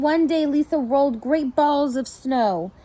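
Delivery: ordinary delivery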